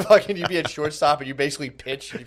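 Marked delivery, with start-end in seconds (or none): 0.1-0.4 laughing through the word; a high laugh on the word. Real, not acted.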